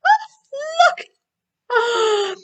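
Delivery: very high squeal of delight; excited showing-off cry. Real, not acted. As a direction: squeal with joy showing your puppet. Showing off the cow puppet.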